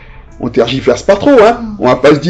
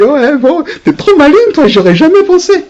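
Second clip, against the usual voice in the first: high, loud ironic praise; mock sarcastic Adam; 12 semitones higher, 4 dB louder than usual. Adam raille Ève qui a mangé le fruit, ton moqueur aigu. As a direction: needle Eve with fake admiration. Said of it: "il a dit".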